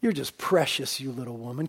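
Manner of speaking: soft, tender voice, as to a little puppy; tender, fond. Acted. Jesus speaking softly and fondly to the woman, tender. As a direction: hush it down and coo it fondly. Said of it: "Can't you hear the Lord speaking like that?".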